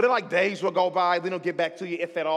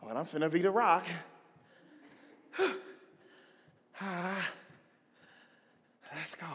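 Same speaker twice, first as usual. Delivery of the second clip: out of breath; 2 semitones lower, 8 dB quieter than usual. Retold or imitated. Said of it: "feeling kinda winded".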